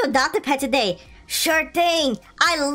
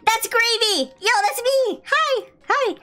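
much higher, gleeful; excited; 10 semitones higher than usual. Real, not acted. Player spotting his own pet, squealing hi. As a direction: squeal at seeing yourself.

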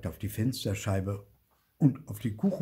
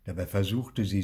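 ordinary delivery

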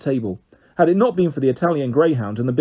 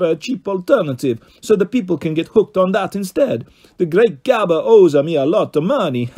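put-on higher Italian-accented boast; Carlos boasting; 5 semitones higher, 4 dB louder than usual. A narrator's reading. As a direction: brag about the raids in Italian. Carlos boasts of his work.

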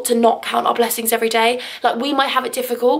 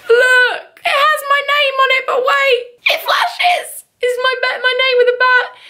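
much higher, louder excited squeal; thrilled fan-gift squeal, higher and louder; squealing excitement; 13 semitones higher, 4 dB louder, slower than usual. Real, not acted. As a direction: gush over the flashing badge. Excited over badge from fan.

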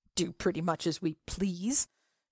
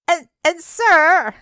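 much higher, louder scream over the din; 11 semitones higher, 12 dB louder, slower than usual. Acted. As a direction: yell it into his ear. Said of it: "screaming in his ear".

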